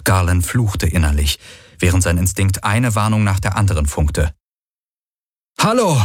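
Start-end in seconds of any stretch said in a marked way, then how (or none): 5.6-6.0 high-pitched hailing call; loud call. A narrator's reading. Man hailing approaching riders, calling out loudly. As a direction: call out to someone far off. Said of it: "so laut, dass Kirai neben ihm zusammenzuckte".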